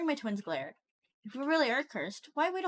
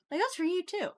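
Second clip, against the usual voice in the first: snapped in a higher voice; 8 semitones higher than usual. A narrator's reading. Mom turning on Freddie at dinner, snapping. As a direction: snap it as a telling-off, pitched up. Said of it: "she snapped at him too".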